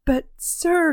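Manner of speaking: put-on high, loud cry of a desperate boy; boy cries out to stop the doctor, high and loud. A narrator's reading. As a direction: cry out, begging. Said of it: "cried the boy".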